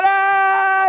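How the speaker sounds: hammed-up infant shriek; mock baby scream. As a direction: wail like a baby again. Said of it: "I start screaming again".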